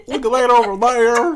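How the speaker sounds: loud, high hillbilly twang; exaggerated high Southern cartoon voice; Plankton's family. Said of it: "you were like Plankton's family on SpongeBob".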